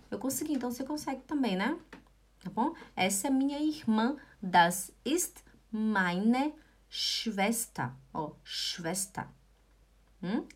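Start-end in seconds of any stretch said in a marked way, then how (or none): 4.4-7.9 slow, word by word; slow model sentence. Shown as a demonstration. Teacher modelling "my sister" for learners, slowly. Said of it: "Repete junto comigo". 8.4-9.3 low, soft, slow "Schwester" ending in "ta"; model pronunciation. Shown as a demonstration. Teacher modelling for learners, soft slow "Schwester" with sh onset. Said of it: "Repete junto comigo".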